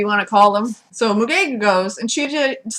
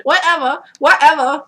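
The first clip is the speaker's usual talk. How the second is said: raised, louder, brushing-off "whatever, whatever"; dismissive whatever; 5 semitones higher, 5 dB louder than usual. Retold or imitated. Danielle to the camera, brushing it off, louder and higher. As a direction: wave it all away, twice. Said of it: "to the camera she said".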